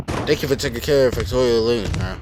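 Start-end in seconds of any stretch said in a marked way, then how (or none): none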